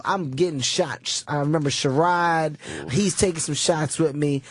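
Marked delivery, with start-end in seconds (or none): none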